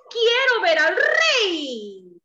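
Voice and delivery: drawn-out cat request; slow lightly voiced cat. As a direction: ask to see the king like a polite cat.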